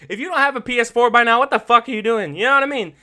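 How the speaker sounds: fast, loud, high incredulous rant; mock outrage. Real, not acted. Podcaster scolding listeners without a PS4, loud and fast. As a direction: scold them in disbelief, fast. Ranting at non-players.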